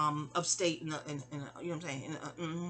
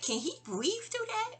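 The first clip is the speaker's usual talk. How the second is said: much higher, slow, small worried voice; childlike worried question; 13 semitones higher, 4 dB quieter, slower than usual. Retold or imitated. Child asks mom about the covered cage, small high voice. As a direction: ask it like an anxious little kid. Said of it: "I was like".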